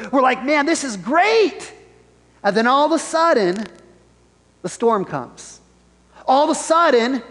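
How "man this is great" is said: high-pitched delight, drawing out GREAT; acted-out contentment, pitched up. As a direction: revel in it, slow and high. Voices people enjoying good times.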